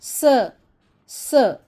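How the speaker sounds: "स" as a short hissed sa, not "es"; letter sound s. Shown as a demonstration. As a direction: sound out "स" crisply. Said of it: "इस आवाज़ के साथ".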